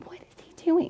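high, hushed, baffled whisper; imitating an embarrassed mother's whisper; urgent stage whisper. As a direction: whisper it to the teacher in disbelief. Acted whisper to the teacher.

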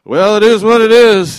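loud, hammed-up shrug of "it is what it is"; mocking the phrase loudly; mock disciples. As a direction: boom it out like a foolish disciple. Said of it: "the disciples looking at him going".

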